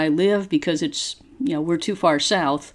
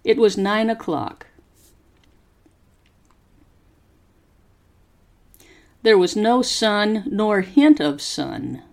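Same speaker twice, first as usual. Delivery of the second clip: slow, careful story reading for students to repeat; slow model reading; 3 semitones higher than usual. Shown as a demonstration. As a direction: pause after each phrase and lift the tune clearly. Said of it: "Copy how my voice goes up and down".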